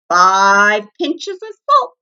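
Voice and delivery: loud, slow barked command; barked order. A narrator's reading. Varmint ordering the bunny about, loud and slow. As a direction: bark out the recipe. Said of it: "barking orders".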